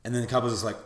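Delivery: ordinary talk, nothing marked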